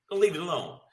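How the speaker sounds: ordinary talk, nothing marked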